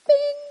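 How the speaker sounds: high, loud sung-out bing; buzzer ding. Pretend. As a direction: imitate a game-show buzzer going off. Imitates a buzzer button sound.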